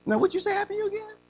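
high, fast mocking friend's voice; incredulous friend. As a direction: tease him like a doubting coworker. Friend mocks the changed man.

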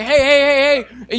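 drawn-out loud distress hail; calling for help. Retold or imitated. Preacher acts out signalling trouble on the lake, loud hail. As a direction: call out slowly to distant boats. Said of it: "supposed to have these little orange flags to say".

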